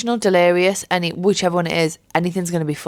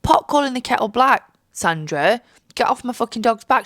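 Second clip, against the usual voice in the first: mock rant at vet; 6 semitones higher than usual. Defending her fat dog against the vet, a mock rant. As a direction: tell the vet off with sass. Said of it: "And I'm thinking".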